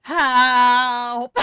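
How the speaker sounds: long, very loud drawn-out yell; hammed-up holler of despair; exasperated cry for help. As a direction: belt out a comic plea for help. Comic despair over English spelling.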